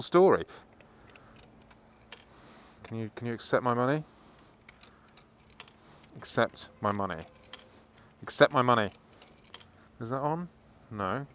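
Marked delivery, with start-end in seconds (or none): 2.8-11.3 soft, low muttered plea; muttering to machine. Real, not acted. Alone with a card machine, soft mutter. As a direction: grumble at the payment machine.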